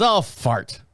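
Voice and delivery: mild mock of his wife; lightly imitated wife; mimicking wife. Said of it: "She started saying fart".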